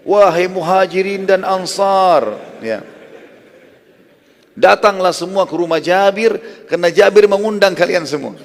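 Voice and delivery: loud drawn-out call to a crowd. Acted. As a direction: shout it across the crowd from a high rock. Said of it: "berdiri di atas batu yang tinggi teriak".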